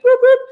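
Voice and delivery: high, loud mock siren whoop; police siren. Mimicking the cop car's siren behind her, loud high whoop.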